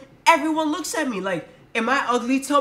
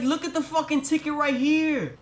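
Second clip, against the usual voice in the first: higher, exasperated retort; insist, pitched up and fed up; indignant protest; 8 semitones higher than usual. Retold or imitated. Pointing out his ticket to staring passengers: high indignant retort. Defending himself on the bus.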